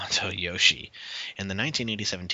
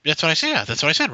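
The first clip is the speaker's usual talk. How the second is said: raised, higher, insisting he was right; loud and higher, stop being misunderstood; exasperated raised insistence; insistent; 8 semitones higher, 5 dB louder, faster than usual. Real, not acted. Insisting in an argument.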